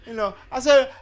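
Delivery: usual speaking voice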